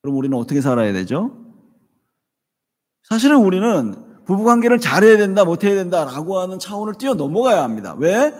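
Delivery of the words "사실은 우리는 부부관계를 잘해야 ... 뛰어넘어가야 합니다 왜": preaching. Real, not acted. Preaching climax.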